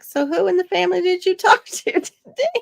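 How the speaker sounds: laughing mock wife voice; teasing wife. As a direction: tease like the wife. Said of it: "She's like".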